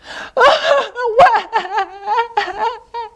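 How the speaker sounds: high wailing laugh-sob; crying laugh. Acted. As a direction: wail it out playfully. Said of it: "said Little Beaver".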